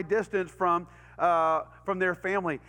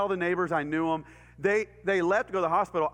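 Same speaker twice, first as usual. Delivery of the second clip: ordinary delivery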